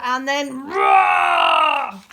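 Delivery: loud, long drawn-out roar; beast roar. A narrator's reading. Narrator bursts into the beast's roar, slow and loud. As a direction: let the roar out slow and huge.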